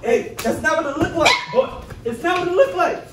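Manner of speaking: high, loud startled pleading; panicked excuse. Real, not acted.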